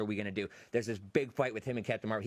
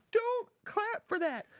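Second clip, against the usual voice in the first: high-pitched mock yell, drawn out; retold yell; 3 dB louder, much slower than usual. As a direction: yell it in a slow, soaring high voice. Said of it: "I literally yelled at them".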